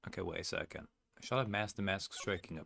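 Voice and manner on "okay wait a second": ordinary talk, nothing marked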